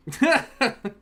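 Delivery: a loud, high laugh; high burst of laughter. Real, not acted. Streamer laughs after waking his kid, high burst of laughter.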